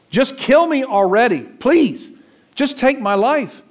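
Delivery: high, loud pleading to be killed; desperate Jonah. Acted. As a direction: beg God for death, voice lifted high.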